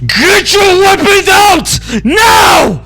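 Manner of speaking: high, slow, loud battle command; commanding warrior goddess. Acted. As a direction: bellow the order to arm. Goddess yells a warning.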